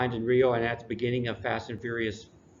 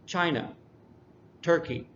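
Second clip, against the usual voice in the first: China and Turkey stressed on the first syllable, higher pitch; 4 semitones higher than usual. Shown as a demonstration. Teacher showing students the first stress pattern, first syllable stressed in China, Turkey. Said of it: "the first syllable is emphasized more".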